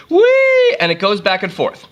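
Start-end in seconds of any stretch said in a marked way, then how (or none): 0.1-0.7 high, loud drawn-out whee; zjeżdżające „whee”. Retold or imitated. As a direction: squeal "whee" like a swinging ball. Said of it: "this thing goes".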